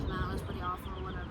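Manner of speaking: ordinary delivery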